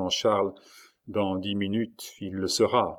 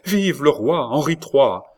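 loud, slow, raised-pitch acclamation; acclaiming cry; 5 semitones higher, 6 dB louder than usual. A narrator's reading. Queen mother hails her son as king, crying out. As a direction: hail the new king out loud. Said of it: "cria alors Catherine".

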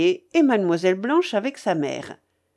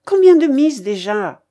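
loud, higher-pitched impatient demand; 5 semitones higher, 9 dB louder than usual. A narrator's reading. Old gambler questions her companion about bets, impatient. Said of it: "en grinçant des dents d'impatience".

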